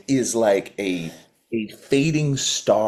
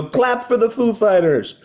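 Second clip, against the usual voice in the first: high, slow stage announcer call; frontman crowd call; 9 semitones higher, slower than usual. Acted. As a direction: work the crowd like a rock singer. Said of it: "he'd go".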